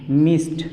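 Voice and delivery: मिस्ट said with a hard final T sound; crisp T at the end of मिस्ट; model pronunciation. Shown as a demonstration. Teacher repeats मिस्ट slowly for the class, ending in a hard T. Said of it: "मिस्ट पढ़ेंगे".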